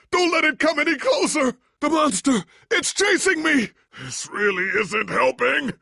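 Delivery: high, loud frantic cries; panicked shouting. Acted. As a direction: yell in terror while fleeing. Chased by monster, panicked.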